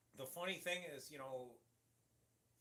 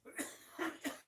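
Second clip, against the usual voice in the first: sharp coughing fit; choking cough; 14 semitones higher than usual. Real, not acted. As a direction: cough hard, something went down wrong. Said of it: "that went down the wrong pipe".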